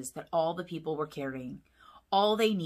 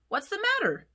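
much higher worried puppy; 14 semitones higher, 4 dB louder than usual. A narrator's reading. Puppy asks worried dad, in a much higher voice. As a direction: squeak it out. Pup voice, high.

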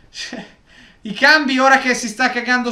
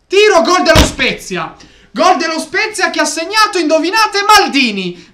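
loud, higher, rushed goal call; breathless italian goal commentary; 6 semitones higher, 6 dB louder than usual. Real, not acted. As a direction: shout the goal out in disbelief. Shouting over opponent's goal.

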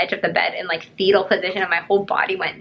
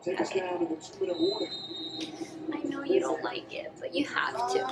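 hushed, soft reassurance; whisper; 3 semitones higher, 12 dB quieter than usual. Real, not acted. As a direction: whisper gently so he stays calm. Feeding the reluctant dog.

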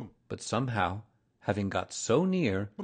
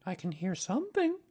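put-on high boyish voice, not raised in volume; listen out as a little boy; child's voice, pitched up; Christopher Robin; 10 semitones higher than usual. A narrator's reading. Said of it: "said Christopher Robin".

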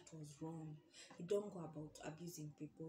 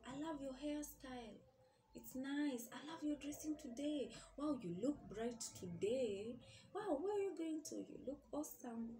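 high-pitched gushing compliments; acted-out excited praise, voice lifted; admiring friend; 6 semitones higher than usual. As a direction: gush like an admiring girlfriend.

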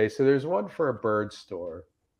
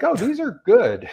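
mock delight; 11 semitones higher than usual. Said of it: "I'm like".

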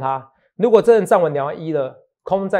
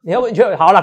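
usual speaking voice

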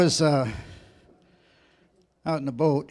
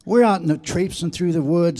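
usual speaking voice

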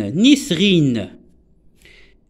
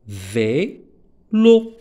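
"Vélo" in two pieces with a long gap between; syllable by syllable; 3 semitones lower than usual. Shown as a demonstration. Teacher repeating the two syllables for pupils, "Vélo" split vé... lo. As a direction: say "Vélo" as vé, pause, lo. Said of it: "on a deux syllabes".

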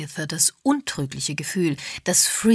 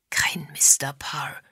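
hushed, low-key aside, slow; whispered; 3 semitones lower, slower than usual. A narrator's reading. Agatha remarks privately to James on the missing husband, whispered. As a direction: murmur it confidentially and slowly. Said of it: "flüsterte Agatha".

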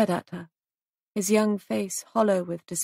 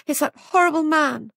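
frightened outburst; 9 semitones higher, 7 dB louder than usual. A narrator's reading. Frightened woman blurts out fear.

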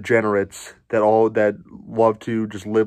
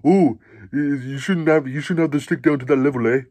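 mock Canadian commentator, light Canadian accent; 2 semitones higher than usual. Mocking a Raptors commentator, lightly Canadian accent. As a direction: tease Canadian politeness with an "eh?". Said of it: "color commentator to be like".

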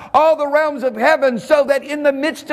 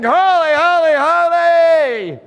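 high, sustained worshipful cry; 6 semitones higher, 4 dB louder, slower than usual. Acted. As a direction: call it out high, holding the last word long. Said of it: "cry as they fly through the atmosphere saying".

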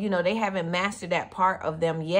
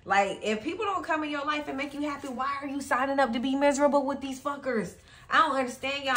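ordinary talk, nothing marked

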